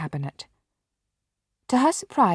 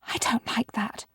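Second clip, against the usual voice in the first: frightened unvoiced whisper; shivering whisper; 3 semitones higher, 7 dB quieter than usual. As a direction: breathe it out, spooked.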